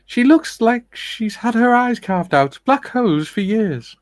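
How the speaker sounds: high, slow posh lady voice. Retold or imitated. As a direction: read it as a grand posh lady. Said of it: "I at least did a posh lady voice".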